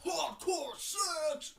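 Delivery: drawn-out intense bellow; growing mock-intense announcement. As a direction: stretch it out far too intensely. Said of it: "Imagine if that man was so intense".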